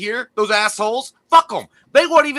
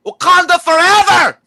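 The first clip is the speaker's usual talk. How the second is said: higher, louder battle call; call sign cry; 6 semitones higher, 4 dB louder than usual. Acted. Co-host giving the vote call sign, rallying cry.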